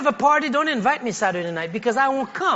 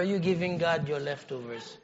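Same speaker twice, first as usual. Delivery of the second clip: soft aside; 10 semitones lower, 13 dB quieter than usual. Acted. Quiet first take of the phrase.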